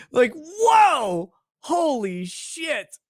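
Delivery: high, then slow disbelief; stunned whoa. Real, not acted. Stunned by the kid's answer.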